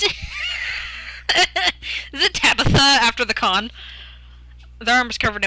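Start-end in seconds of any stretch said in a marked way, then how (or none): 0.0-3.7 shrieking laugh into question. Real, not acted. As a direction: laugh it out loud. Bursting laughter.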